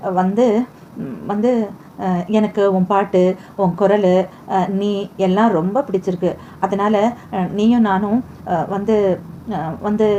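halting, slow, pausing between every word; hesitant stammer. Acted. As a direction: fumble for the words, stopping and restarting on வந்து. Shy man fumbling a confession. (தயங்கித் தயங்கிப் பேசுதல்)

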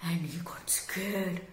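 breathy hushed narration, voice dropped low; storyteller whispering the scary bit. A narrator's reading. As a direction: whisper it like a scared secret. Scared Bernie, told hushed.

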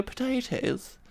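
high, soft put-on posh voice; small high mocking voice; posh English mockers. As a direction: imitate posh teasing, high and quiet. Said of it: "And they go".

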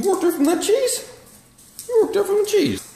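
very high, cooing pet voice. Real, not acted. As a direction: coo at the dog in a squeaky voice. Baby talk to the dog.

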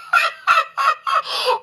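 high giggling fit; helpless laugh. Acted. Monkey cracks up over the injection, laughing. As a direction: laugh as if unable to stop. Says can't hold himself back.

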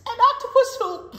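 loud, high storybook gush; excited build. Acted. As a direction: keep the thrill rolling.

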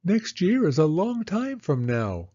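put-on high child's voice, a touch downcast; a small girl giving up hope; little girl Penny. Penny telling Rose it won't snow, high childlike voice.

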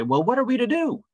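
acted high-pitched plea from the crowd; crowd's plea. Crowd answering John's harsh words, high-pitched mock plea.

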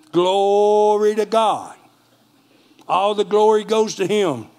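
slow preacher's praise over the winning number. Real, not acted. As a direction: stretch it out in worship. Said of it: "praising at the draw".

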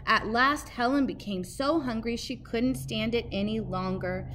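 ordinary delivery

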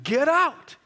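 loud, high yell; furious shout. Retold or imitated. Furious resident chasing the visitor out, shouting high. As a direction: yell it like someone hurling things at you. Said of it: "somebody would throw something at me".

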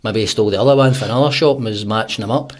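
normal talking voice

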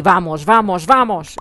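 "vamos" imitado con voz ajena, un poco más fuerte; imitando cómo lo decía. Retold or imitated. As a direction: remeda a quien lo decía, animándolo. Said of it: "decía así". (imitación ligera de alguien animando)

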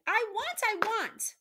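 high, loud repeated cry; hammed-up high bawling; acted sobbing wail. A narrator's reading. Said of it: "sobbed Meg".

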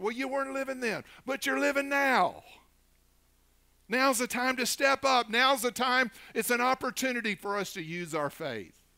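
high, loud, urgent exhortation; full-force preaching. Real, not acted.

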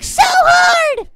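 slow, high, loud emphasis; emphatic shout. Real, not acted. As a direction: drag it out, loud. Stressing rarity.

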